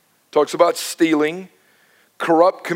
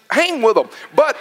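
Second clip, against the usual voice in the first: normal talking voice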